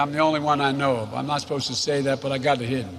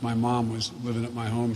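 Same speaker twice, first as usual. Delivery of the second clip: ordinary delivery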